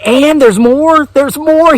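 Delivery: high, slow, stunned gasp; amazed viewer. Retold or imitated. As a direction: play the gobsmacked viewer. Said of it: "you're like".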